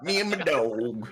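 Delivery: slow, drawn-out mock voice; mock dopey voice. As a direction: drag it out like a lazy chiller. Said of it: "Stan had to say it like that".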